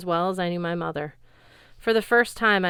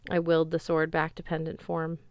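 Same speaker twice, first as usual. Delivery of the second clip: plain reading voice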